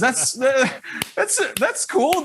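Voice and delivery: covering disappointment with a fake-cheerful compliment; forced enthusiasm. Host covers his disappointment.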